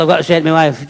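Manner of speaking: ordinary talk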